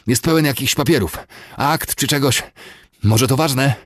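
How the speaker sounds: high, loud, winded report; panting. A narrator's reading. Rider tells the colonel about the papers, panting. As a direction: report urgently, short of breath. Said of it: "wysapał przybysz".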